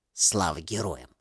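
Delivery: put-on low, hushed old woman's voice; old woman whispering. A narrator's reading. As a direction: whisper it like a frail grandmother. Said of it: "прошептала бабушка".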